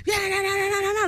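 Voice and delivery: high, nagging mock babble; hammed-up high-pitched yapping; imitated bickering. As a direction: mimic people squabbling in a high voice. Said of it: "bickering back and forth".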